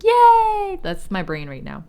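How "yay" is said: high, loud mock kids' yay; canned kids cheer. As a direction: imitate a cheering crowd of kids. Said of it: "a bunch of kids going like".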